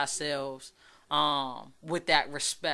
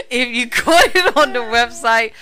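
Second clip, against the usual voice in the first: higher, louder, laughing through the words; real laughter stretching out the line; 7 semitones higher, 11 dB louder than usual. Real, not acted. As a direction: repeat it while cracking up. Repeats sign-off line while laughing.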